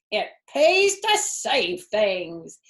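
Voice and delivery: a touch of a surly rat, slow and grudging. Acted. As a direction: drag it out grudgingly, lightly surly. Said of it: "he said in his surly voice".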